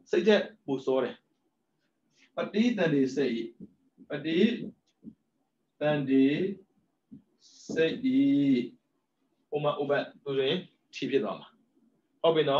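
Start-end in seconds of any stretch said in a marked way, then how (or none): 4.1-8.2 ပဋိ, သန္ဓေ, စိတ်ဤ with long pauses between; syllable by syllable. Shown as a demonstration. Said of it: "split into parts next".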